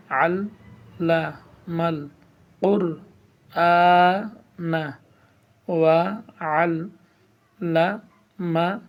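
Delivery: slow, broken-up reading with a pause after each syllable. Shown as a demonstration. Teacher to viewers, reading the hadith in slow separate syllables. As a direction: sound out each piece of the hadith alone. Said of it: "ভেঙে ভেঙে পড়া".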